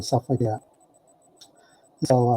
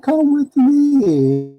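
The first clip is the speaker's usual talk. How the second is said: much higher, very slow and drawn out; ominous invite; 11 semitones higher, 3 dB louder, much slower than usual. Retold or imitated. Faley takes the teller to see the carcass, slow and high. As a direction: lead him outside, slowly. Faley leads him outside.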